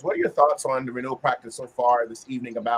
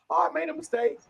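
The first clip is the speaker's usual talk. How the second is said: vague excuse. Shown as a demonstration. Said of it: "But I just say".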